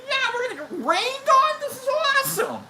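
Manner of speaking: very high, loud squeal; over-the-top excitement. Retold or imitated. Storyteller replays reacting to coming rain, high exaggerated enthusiasm. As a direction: squeal with hammed-up delight.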